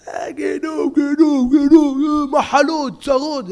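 high, loud doom-shouting; hammed-up hellfire rant; mock hellfire preacher. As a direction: parody the scare preacher. Mocking fire-and-brimstone preacher.

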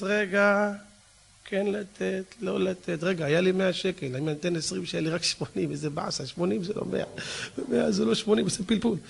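mock stingy man counting coins under his breath; quiet, grudging calculation of a tightwad; miser muttering to himself. Miser calculating before giving. (קמצן סופר בשקט לעצמו)

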